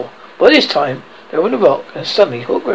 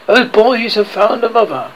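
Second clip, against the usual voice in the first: raised, passionate exclamation; passionate cry; 6 semitones higher, 4 dB louder than usual. A narrator's reading. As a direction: declare it with dismay and passion. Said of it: "he spoke passionately".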